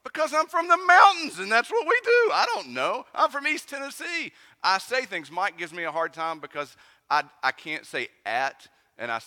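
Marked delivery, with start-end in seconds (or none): 0.0-4.3 loud, high retold protest; husband pleading loudly with his wife; defensive outburst. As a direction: blurt out an exasperated defense, much higher. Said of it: "I'm like".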